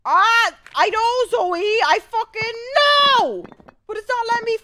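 high, very loud frustrated shouting; high-pitched outburst of pure frustration; exasperated yelling. Real, not acted. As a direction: scream back at a nagging game character. Yelling back at game character.

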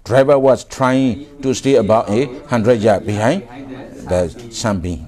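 very slow, word by word textbook reading; slow read-aloud. Shown as a demonstration. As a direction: spell out each word slowly for the class. Teacher reads textbook sentence aloud.

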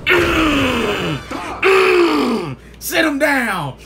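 loud, high grunts into a shout; overexcited fighting yell; too hyped. Real, not acted. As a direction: grunt and holler, carried away by the fight. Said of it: "I'm getting too hyped with this".

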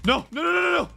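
rapid-fire high cries; panicked no-no-no. Real, not acted. Player dying yet again, panicked no-no-no. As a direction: repeat it high and frantic.

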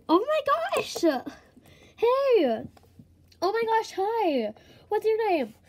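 put-on over-the-top excited girl; excited squeal. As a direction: gush over meeting someone new. Excited sister greets the new neighbor.

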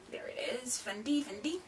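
soft whispered Fendi, Fendi; whispered brand name. Real, not acted. Vlogger whispering the bag's brand to viewers, hushed. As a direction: breathe the name like a secret treasure.

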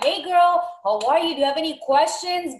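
bubbly friendly follow-up; excited. Shown as a demonstration. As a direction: coach checking in on a client, upbeat. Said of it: "checking in after a sale".

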